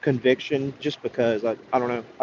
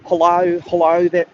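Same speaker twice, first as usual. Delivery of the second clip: higher, louder put-on Australian greeting; Australian accent; 6 semitones higher, 5 dB louder, slower than usual. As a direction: greet them like a hammed-up Aussie. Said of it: "Australian people say hello".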